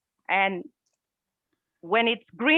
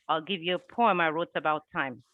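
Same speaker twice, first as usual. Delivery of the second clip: usual speaking voice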